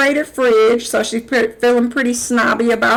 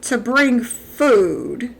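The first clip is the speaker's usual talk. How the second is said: slow accusing question; much slower than usual. Real, not acted.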